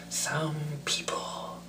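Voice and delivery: slow, whispered raspy villain; Joker impression. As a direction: savour the menace slowly. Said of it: "I will try just for you".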